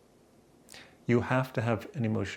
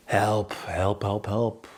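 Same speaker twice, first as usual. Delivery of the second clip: mock cry for help; much slower than usual.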